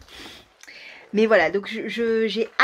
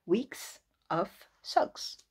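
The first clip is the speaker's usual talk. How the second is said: "Weeks of Socks" drawn out in a mock English accent; slow careful English title; 4 dB quieter, much slower than usual. Retrying the book title in a hammed English accent. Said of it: "Quel accent merveilleux".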